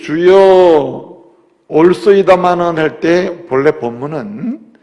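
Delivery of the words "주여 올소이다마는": slow, louder pleading Lord; drawn-out plea. Acted. Pastor voicing the woman's humble reply, slow and pleading. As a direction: stretch each word like a humble plea.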